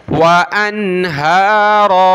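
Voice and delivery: अनहारा ending on a long open aa, no n; slow अनहारा with held closing vowel; pausal ending. Shown as a demonstration. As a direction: stretch the final aa of अनहारा for the stop. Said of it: "वक़फ़ करेंगे तो".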